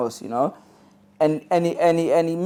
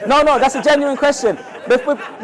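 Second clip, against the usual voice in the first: laughing; 10 semitones higher, 6 dB louder than usual. Real, not acted. Laughing at audience member's answer.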